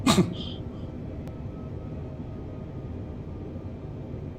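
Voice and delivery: quiet unvoiced chuckle; breathy laugh. Real, not acted. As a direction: laugh it off softly. Laughs after messing up.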